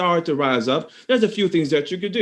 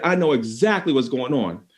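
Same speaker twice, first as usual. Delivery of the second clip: ordinary delivery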